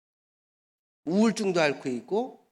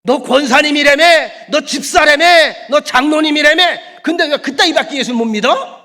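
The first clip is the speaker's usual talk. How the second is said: loud high jeering shout; loud high taunting shout; mocking rebuke; 7 semitones higher, 12 dB louder than usual. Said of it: "호통을 당하는 거예요".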